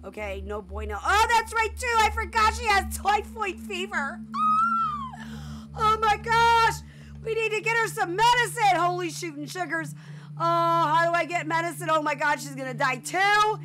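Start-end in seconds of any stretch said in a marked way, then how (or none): 1.0-4.2 high, loud burst of panic; alarmed realization. Real, not acted. Alarmed at remembering the sick Sim. 4.2-5.7 high, drawn-out wailing gasp; panicked shriek. Real, not acted. Panics over the sick Sim. 5.8-13.6 high, frantic game-player panic; loud panic. Real, not acted. Panics over getting medicine for Sim.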